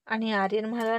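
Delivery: ordinary delivery, nothing marked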